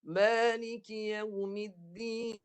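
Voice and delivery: Maliki yaumiddin drawn out slowly, nun hummed in the nose; slow recitation. Shown as a demonstration. Teacher demonstrating recitation to students slowly. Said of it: "Dengar saya baca".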